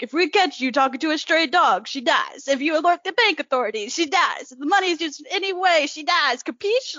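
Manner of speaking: mock Godfather mobster voice; hammed-up New York tough guy; New York gangster accent. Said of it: "you could read in a New York accent".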